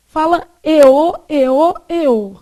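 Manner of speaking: eô said open and oral, not through the nose; oral eô demo. Shown as a demonstration. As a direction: repeat eô clearly with no nasal sound. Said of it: "O que é nasal não é aqui".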